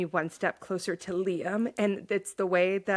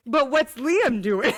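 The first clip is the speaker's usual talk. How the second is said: slow, louder pleading question; whiny plea; 4 semitones higher, 6 dB louder, slower than usual. Retold or imitated. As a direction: beg the author about Liam. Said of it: "I'm like".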